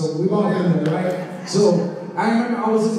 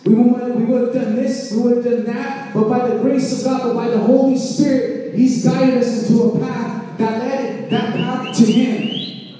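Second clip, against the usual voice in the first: raised, ringing preacher voice; preaching at full force; 4 semitones higher, 5 dB louder than usual. Acted. Pastor urging the congregation, full preaching voice. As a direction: preach it out to the whole room.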